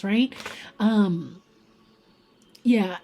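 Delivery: usual speaking voice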